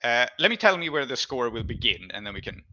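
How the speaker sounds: ordinary talk, nothing marked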